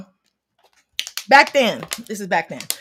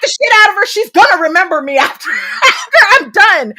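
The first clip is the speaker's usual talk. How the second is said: furious threats; 8 semitones higher, 8 dB louder than usual. Retold or imitated. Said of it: "I was like".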